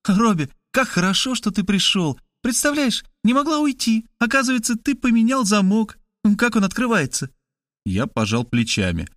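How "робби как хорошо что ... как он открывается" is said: a higher, lighter woman's voice; Kristina's voice. Acted. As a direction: play the sister, pitched up and urgent.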